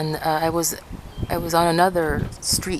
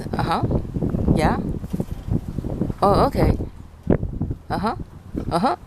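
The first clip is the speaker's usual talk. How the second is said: listening noises. Shown as a demonstration. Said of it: "You know, like that".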